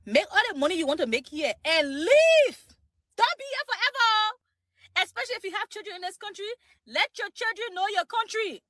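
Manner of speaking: loud, high Liberian English preaching; Liberian accent. Shown as a demonstration. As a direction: urge them in a Liberian accent. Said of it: "I'm gonna put in my Liberian accent".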